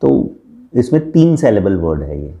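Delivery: ordinary delivery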